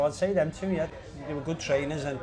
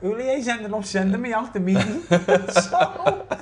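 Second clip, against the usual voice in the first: giggling through the words; can't stop laughing while telling; 7 semitones higher, 7 dB louder than usual. Real, not acted. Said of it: "I've cracked up".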